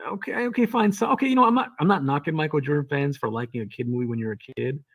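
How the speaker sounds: ordinary delivery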